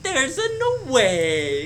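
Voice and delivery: high, slow, drawn-out Mario voice; Mario impression. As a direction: drag every word out like Mario. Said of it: "channeled his inner Mario".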